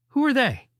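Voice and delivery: casually asked, voice lifted; casual question. A narrator's reading. Asking her about the framed photo, casually. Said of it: "I asked casually".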